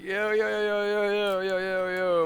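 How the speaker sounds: high, rapid string of yo's; mock-panicked plea, yo's running into each other; alarmed protest. Bystander to cops with hands on guns, alarmed protest.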